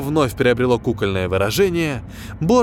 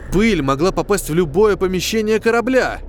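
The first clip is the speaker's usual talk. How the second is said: plain reading voice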